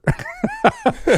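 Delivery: long buzzing raspberry noise; imitated loud fart. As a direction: blow a big mock fart sound. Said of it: "this really loud fart".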